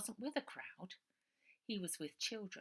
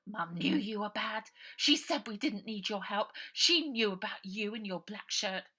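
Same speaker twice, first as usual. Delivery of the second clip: 1 angry accusation; 4 semitones higher, 10 dB louder than usual. A narrator's reading. Ida accuses.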